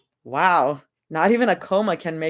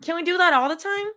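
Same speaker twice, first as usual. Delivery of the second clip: faster, higher eager repeat; 10 semitones higher, faster than usual. Acted. Repeating herself eagerly after being asked what. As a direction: say it again, quick and high, so they hear.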